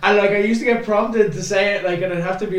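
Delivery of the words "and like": ordinary talk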